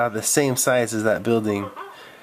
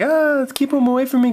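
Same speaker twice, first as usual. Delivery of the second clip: high, loud terrified begging; panicked plea; 13 semitones higher, 3 dB louder than usual. Acted. Roy in the corner begs the class, panicked. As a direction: scream it while backing away. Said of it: "Roy was like".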